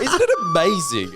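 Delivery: very high, laughing exclamation; excited. Real, not acted. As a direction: squeal it out in delight.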